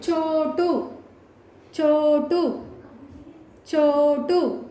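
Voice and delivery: "చో" lengthened each time in "చోటు"; drawn-out long vowel. Shown as a demonstration. Teacher introducing a new spelling word, long "చో". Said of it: "ఎక్కడ దీర్ఘం తీస్తున్నాము".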